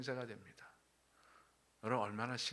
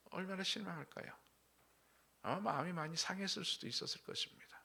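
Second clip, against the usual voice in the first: ordinary talk, nothing marked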